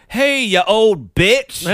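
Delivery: loud, high, slow mock shout; rude greeting. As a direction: holler it across the market, slowly. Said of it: "being like".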